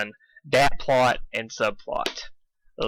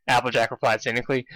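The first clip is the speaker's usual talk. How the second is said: ordinary delivery, nothing marked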